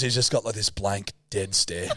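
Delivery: ordinary talk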